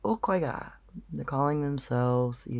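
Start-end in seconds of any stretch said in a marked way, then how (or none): 0.0-0.7 a light high imitation of a repeated Kwaiga; lightly mimicked echo of a strange word; researchers repeating the call. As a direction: echo the call back, a touch higher. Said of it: "which they do".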